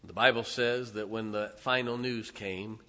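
ordinary talk

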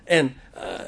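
normal talking voice

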